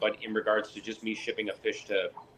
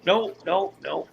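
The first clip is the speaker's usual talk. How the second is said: mock innocent denial; 8 semitones higher, slower than usual. Himself answering officer, high.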